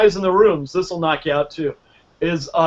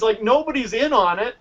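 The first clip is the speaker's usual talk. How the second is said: usual speaking voice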